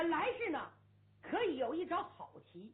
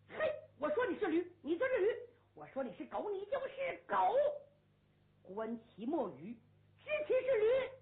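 angry retort; 6 semitones higher than usual. Acted. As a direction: rub the insult in with mock solemnity. Quarrel, red-faced player retorts.

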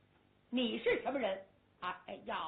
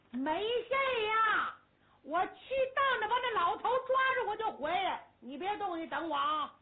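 put-on carefree young general; 7 semitones higher, 3 dB louder than usual. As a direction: brush off the warning. 先锋官满不在乎. (满不在乎的先锋官)

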